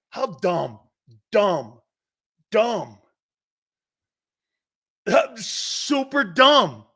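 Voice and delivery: taunting his co-host, each dumb heavier; mocking emphasis. Real, not acted. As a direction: pound each dumb, stretch the last. Mocking the co-host's opinion.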